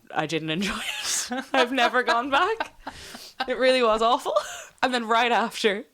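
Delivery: giggling while telling the awful bit; laughing. Real, not acted. Laughing about bad massage.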